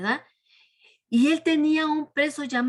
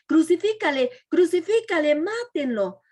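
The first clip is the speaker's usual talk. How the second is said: crowd shouting; 5 semitones higher, 4 dB louder than usual. Acted. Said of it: "empezaron a decir".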